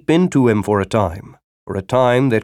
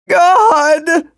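high anguished outcry; strangled cry; 5 dB louder than usual. Acted. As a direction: cry out in agony. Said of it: "came the strangled cry".

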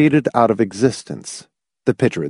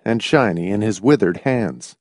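plain reading voice

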